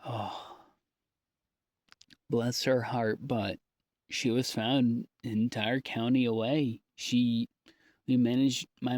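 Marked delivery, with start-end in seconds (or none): none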